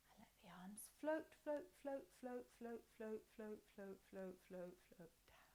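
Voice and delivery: hushed repeated float, float, float; soft floaty whisper. Shown as a demonstration. Teacher whispering to kids as arms float down.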